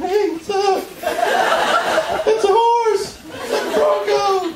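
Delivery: high, squeaky defensive voice; defensive kid. Retold or imitated. Boy explaining his mom's jeans to the bully, high squeaky protest. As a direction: squeak it out like a flustered sixth grader.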